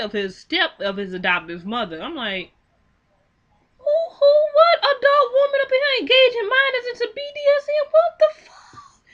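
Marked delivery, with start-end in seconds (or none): 3.8-9.0 high, loud outraged cry, ending in a strained whisper; outraged disbelief. Real, not acted. Outraged at the woman who abused him, high shocked shout. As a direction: shriek your disbelief then drop to a hissed whisper. Outraged at adoptive mother story.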